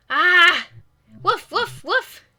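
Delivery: loud high yelp, then quick woofs; high-pitched spoken dog barks; startled dog barking. Acted. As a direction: bark excitedly like a startled dog.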